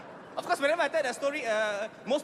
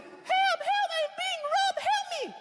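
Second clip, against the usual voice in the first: high falsetto squeal of a robbed woman; hammed-up helpless lady shrieking for help; mock lady's cry for help; much slower than usual. Joking comic mimics robbed lady, high falsetto cry for help. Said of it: "the poor lady is like".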